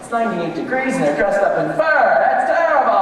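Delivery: rising to a loud fed-up outburst; building irritation into a loud gripe; exasperated complaint. Pretend. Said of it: "a person who's having a terrible day".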